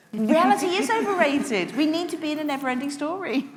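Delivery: declaring it with a laugh in the voice; mock grand pronouncement. Real, not acted. At a book event, joking to the audience, laughing grandly.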